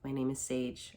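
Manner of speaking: ordinary talk, nothing marked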